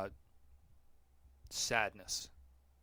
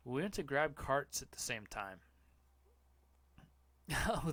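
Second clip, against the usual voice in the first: ordinary delivery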